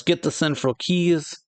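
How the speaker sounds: mild yell. Acted. Farrod ordering the keys taken, mild yell. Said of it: "Farrod yells".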